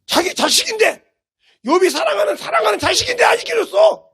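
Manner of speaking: high, loud cry of protest; impassioned shout. Real, not acted. Preacher pleads over Job's beloved children, impassioned shout. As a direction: build to a high-pitched shouted climax.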